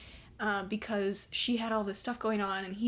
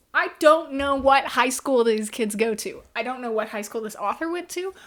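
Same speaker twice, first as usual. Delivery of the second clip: exasperated; 4 semitones higher, 11 dB louder than usual. Real, not acted. Reviewer ranting to viewers, loud and exasperated. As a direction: half-shout your disbelief at the book. Exasperated rant about the book.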